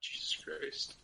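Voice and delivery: breathy whispered aside. Real, not acted. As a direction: whisper it under your breath. Reacting to the voice.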